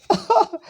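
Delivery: short, high-pitched laugh; quick burst of laughter; amused laugh. Real, not acted. Speaker about to quote roommate's strange answer, amused laugh.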